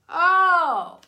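high, loud delighted oh; surprised oh at the welcome card. Real, not acted. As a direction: gasp it out as the card turns. Card revealed, reacts.

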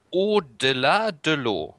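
slow, careful schoolbook French; clumsy French. Real, not acted. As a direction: sound it out in French. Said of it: "Dein Französisch ist".

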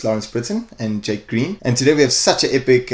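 ordinary talk, nothing marked

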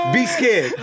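normal talking voice

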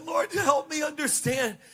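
high-pitched, earnest appeal to the Lord; humbled plea. Retold or imitated. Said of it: "I began to be humbled in that moment".

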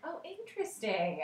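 intrigued. Retold or imitated. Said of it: "I was like".

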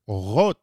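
"Orot" with a throaty, guttural R; Hebrew resh. Shown as a demonstration. Teacher contrasting a harder example, "Orot" with Hebrew resh. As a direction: make the R in "Orot" at the back of the throat. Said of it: "it's harder".

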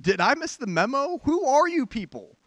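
high, loud mock bewilderment; baffled newcomer. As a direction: react like an outsider thrown by church lingo. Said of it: "And I'm like".

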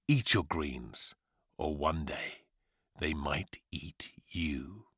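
soft, low, slowing down; hushed cautionary ending, sinking away; ominous warning. Acted. Narrator warns children to eat greens, ominous.

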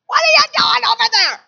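loud high shout across the yard; yelling at the dog. Retold or imitated. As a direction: shout fast at the dog over there. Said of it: "I'm yelling at".

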